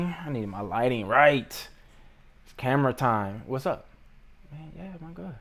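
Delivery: muffled aside off camera; off-mic aside. Real, not acted. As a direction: talk off to the side, away from the mic. Adjusting the lighting off-camera.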